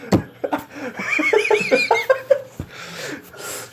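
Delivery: wheezing silent laugh, very high; airy laughter fit; breathless high laugh. Real, not acted. Laughing at his own story.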